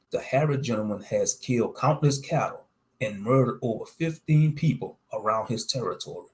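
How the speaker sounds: ordinary delivery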